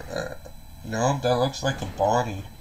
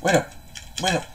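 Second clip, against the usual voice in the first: ordinary talk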